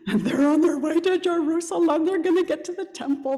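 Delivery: high, eager telling; a touch of pilgrims' excitement; excited. Retold or imitated. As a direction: bubble over with excitement.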